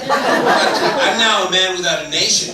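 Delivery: high, loud comic despair; mock exasperation. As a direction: wail it in hammed-up exasperation. Retold comic exasperation at lost accent.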